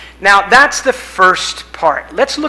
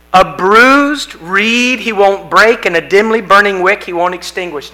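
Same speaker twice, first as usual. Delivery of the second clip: scripture reading; 5 dB louder than usual. Acted.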